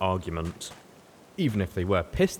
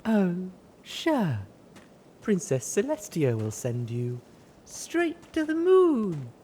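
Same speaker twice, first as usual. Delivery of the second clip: dazed dreamy voice; 11 semitones higher, slower than usual. A narrator's reading. Dazed mare.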